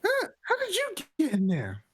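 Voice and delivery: high, loud startled cry. Acted. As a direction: jump with shock and shout it. Startled by a man springing out.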